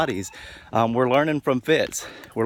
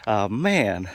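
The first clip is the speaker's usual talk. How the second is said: normal talking voice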